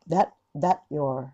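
slow "that, that you're", t left unreleased; slow breakdown. Shown as a demonstration. Teacher slowing the phrase down for students. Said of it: "みんな逆に揃えるなら".

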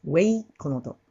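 ordinary talk

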